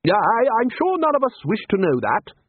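high-pitched, interrupting protest; first objection. A narrator's reading. Ridcully cutting off talk of woodcuts, protesting in high pitch. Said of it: "repeated in a louder voice".

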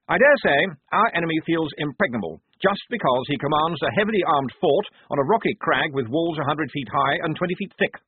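addressing the men with eager self-importance; the lieutenant briefing his squad; earnest young officer. A narrator's reading. Blouse explains the fort.

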